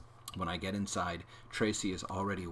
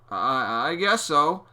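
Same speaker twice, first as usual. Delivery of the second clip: rattled hesitation; 6 semitones higher, 11 dB louder, slower than usual. A narrator's reading.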